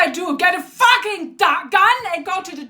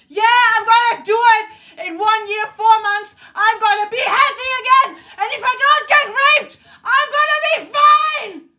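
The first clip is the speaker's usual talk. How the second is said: high, building to a real shout; defiant outburst; 6 semitones higher than usual. Real, not acted. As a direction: insist angrily, climbing to a yell at the end. Defiant outburst against blame.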